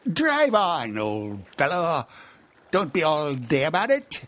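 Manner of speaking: high, loud impatient push; impatient Gryphon. Acted. Gryphon urging the Mock Turtle on, loud and impatient. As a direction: hurry him along.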